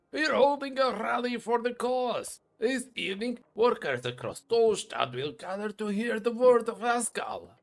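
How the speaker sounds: lightly voiced dwarf rallying the workers.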